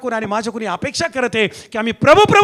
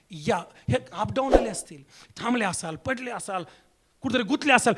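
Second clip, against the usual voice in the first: ordinary delivery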